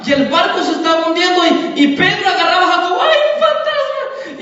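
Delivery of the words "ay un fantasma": very high, drawn-out wail of alarm; hammed-up panic, pitched up without extra volume; mock frightened scream. Preacher playing Peter grabbing Jacobo in fright, mock scream. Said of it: "se imagina Pedro gritando".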